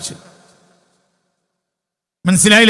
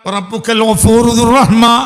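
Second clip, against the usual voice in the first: louder, slow chanted Quran verse; loud recitation; 3 semitones higher, 7 dB louder than usual. Shown as a demonstration. Preacher to congregation, loud slow recitation. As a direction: proclaim the verse slowly to the hall. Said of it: "അമ്പത്തിയെട്ടാമത്തെ ആയത്താണ്".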